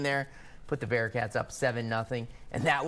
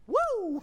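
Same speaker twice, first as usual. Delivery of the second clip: high-pitched "woo"; quick, high cheer; excited whoop; 3 dB louder than usual. Real, not acted. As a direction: let out a high celebratory whoop. Cheering the tour's clean record.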